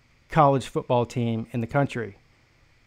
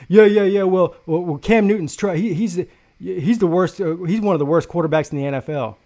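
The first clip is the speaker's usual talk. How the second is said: flustered backpedaling; 3 semitones higher, 6 dB louder than usual. Retold or imitated. Rival fan flustered after a comeback.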